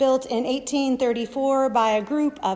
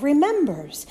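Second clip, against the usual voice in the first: normal talking voice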